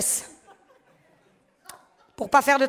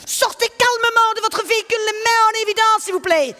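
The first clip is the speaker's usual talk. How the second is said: much higher, louder Belgian-Canadian cop voice; cop accent; 10 semitones higher, 5 dB louder than usual. Retold or imitated. A cop ordering the driver out, loud mixed Belgian-Canadian accent. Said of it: "c'est un mélange de Knokke-le-Zout".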